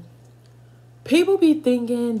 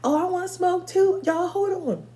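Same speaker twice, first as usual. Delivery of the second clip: eager younger self; 9 semitones higher than usual. Retold or imitated. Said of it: "And I'm like".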